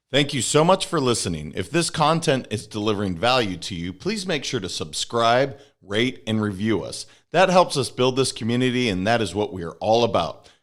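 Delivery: punchy, loud outro read to listeners; podcast outro read. Real, not acted. Host thanking listeners and asking them to subscribe, punchy outro read. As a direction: deliver a polished, emphatic sign-off.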